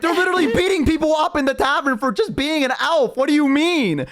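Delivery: very high, incredulous mock elf voice; appalled elves. Elves arguing with their friend, incredulous. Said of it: "And they're like".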